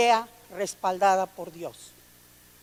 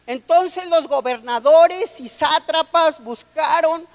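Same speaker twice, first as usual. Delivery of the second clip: sermón proclamado con fuerza, más alto; predicación enérgica; 4 semitones higher, 6 dB louder than usual. Acted. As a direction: predicar con fervor y volumen. (voz de predicador que se eleva y proclama)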